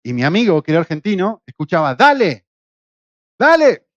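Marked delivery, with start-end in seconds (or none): 1.9-3.9 loud Argentine dale; urging shout. Retold or imitated. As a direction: shout him on to pull.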